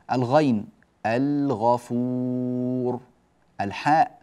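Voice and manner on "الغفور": الغفور with the ل held before غ; slow, sustained الغفور, long final vowel; clear lam demo. Shown as a demonstration. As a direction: model الغفور with every sound stretched. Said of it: "هكذا اللامات ظاهرة هنا".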